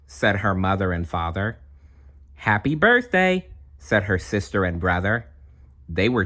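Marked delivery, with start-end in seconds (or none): 2.3-3.5 put-on very high, piping little-kid voice; toddler twins voice. Toddler twins squeal happy birthday in piping voices.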